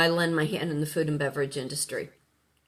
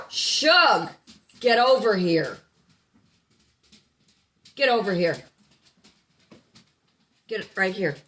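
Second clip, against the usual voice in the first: loud, high firm command; stern dog command, louder; 3 semitones higher, 5 dB louder than usual. Real, not acted. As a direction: order the dog over, no nonsense.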